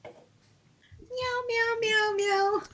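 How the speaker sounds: high cat meows. Pretend. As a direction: meow like a kitten. Mimics cat.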